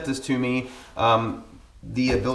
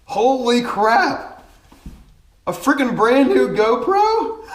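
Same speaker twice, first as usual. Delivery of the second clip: real high, loud, drawn-out amazement; ecstatic shock; 12 semitones higher, 5 dB louder, much slower than usual. Real, not acted. Vlogger unwrapping a brand new GoPro, ecstatic. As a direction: blurt it out high and loud, overjoyed and disbelieving. Opens surprise GoPro gift.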